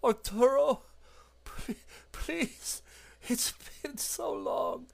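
high, thin and breathy, fading to nothing; pleading flesh-creature voice. Acted. A melted woman in a box begs Arturo, high and pleading. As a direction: beg pitifully from a rotted body. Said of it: "And the mouth goes".

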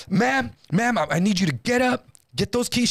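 acted-out robber's instruction; urgent command. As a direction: order her up, insistently. Acting out robber ordering the clerk.

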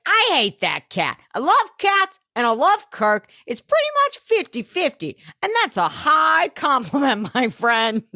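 high-pitched, squawky cat lover; Babette. Retold or imitated. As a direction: play her as an excitable, high-voiced eccentric. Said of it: "she's like".

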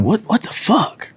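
high, slow, hushed disbelief; a stunned, breathy what the fuck; shocked whisper-ish. Retold or imitated. Kid seeing cops outside, stunned and hushed.

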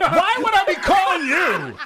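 shouting through laughter; laughing shout. Real, not acted. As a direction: yell it while cracking up. Yelling back at phone recording.

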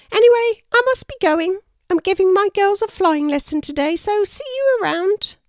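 very high, loud bird character voice; high, projected storybook kookaburra; chirpy kookaburra. A narrator's reading. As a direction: call out a high-pitched goodbye as a kookaburra. Kookaburra says goodbye in story.

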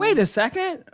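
high, sudden indignant stop; indignant surprise. Retold or imitated. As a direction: catch the injustice mid-sentence. Noticing engineer took his idea.

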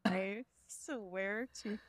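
slow, shaken and spooked; real fear after a noise; adrenaline shakes. Real, not acted. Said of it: "my heart is pounding right now".

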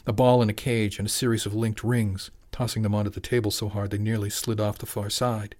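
plain reading voice

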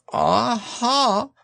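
high little-creature voice; Piglet's feeble aha. A narrator's reading. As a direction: attempt a triumphant aha in a high voice. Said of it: "said Piglet as well as he could".

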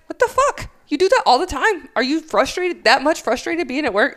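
high-pitched, retold exasperation; exasperated confrontation. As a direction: confront the supervisor in disbelief. Acted confrontation of huffing supervisor.

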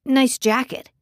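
a hint of an eager young man. Ricky voiced by narrator.

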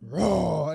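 big, loud roar; Baloo's demonstration roar. Acted. Baloo teaches Mowgli to roar, loud roar. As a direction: show the kid a proper full roar. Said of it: "you gotta do it like this".

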